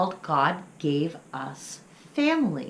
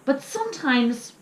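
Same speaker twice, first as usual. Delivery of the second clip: grumbling little pup; 5 semitones higher, 7 dB louder than usual. Acted. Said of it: "Little Pump grumbled".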